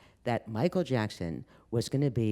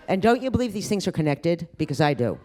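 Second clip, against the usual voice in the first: excited; 6 semitones higher, 5 dB louder than usual. Real, not acted. Teasing joke.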